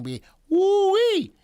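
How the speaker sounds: high, loud Cajun holler; Cajun accent. Retold or imitated. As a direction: hoot it out in a put-on Cajun accent. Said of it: "that's my Cajun accent".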